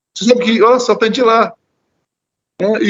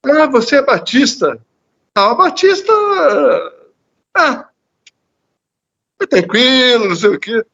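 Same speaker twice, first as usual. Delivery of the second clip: higher-pitched, dismissive mock voice; imitating lax believer; 5 semitones higher than usual. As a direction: mimic someone casually shrugging it off, pitched up. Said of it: "quando alguém diz assim".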